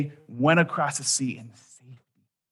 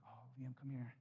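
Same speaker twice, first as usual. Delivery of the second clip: put-on much softer, gentle call to a hurt child; tender comfort; 25 dB quieter than usual. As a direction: soothe a crying little boy. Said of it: "And I was like".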